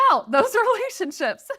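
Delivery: a real laugh breaking into the last words. Real, not acted. Host laughs as she finishes her advice, laughing.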